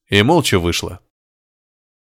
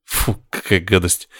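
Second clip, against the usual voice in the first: lower, grimacing revulsion; disgusted; 3 semitones lower, 4 dB quieter than usual. A narrator's reading. Patient winces at the nurse's answer, disgusted. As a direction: recoil with an ugh of distaste. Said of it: "скривился Горишвили".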